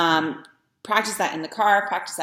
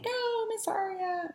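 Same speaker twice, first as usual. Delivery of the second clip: put-on high, drawn-out singsong children's voice; children answering; 6 dB quieter, slower than usual. Students answering their teacher, high singsong imitation. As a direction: imitate a class of kids, slow and high. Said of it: "And they say".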